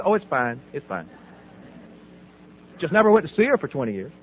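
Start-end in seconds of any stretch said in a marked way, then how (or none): 0.0-1.1 low, trailing-off brush-off; dismissive. Retold or imitated. Son brushing off question about mother, quietly dismissive. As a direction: shrug it away, softer on the repeat.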